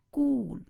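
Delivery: कूल with a long drawn "oo"; long vowel. Shown as a demonstration. Teacher showing the long sound, कूल drawn out. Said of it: "उसमें long होता है".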